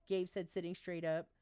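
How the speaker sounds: ordinary delivery, nothing marked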